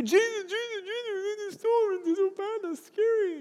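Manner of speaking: high, frantic voice of scared disciples; panicked disciples. Retold or imitated. Pastor voices the disciples waking Jesus in the storm, high panicked cry. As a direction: squeal it like terrified followers in a storm. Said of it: "And his disciples are freaking out".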